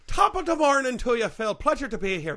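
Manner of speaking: put-on hearty stage Irish accent. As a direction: greet them in a jolly Irish accent. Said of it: "you've got such an Irish accent".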